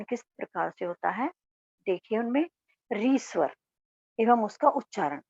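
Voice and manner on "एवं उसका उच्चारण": ordinary delivery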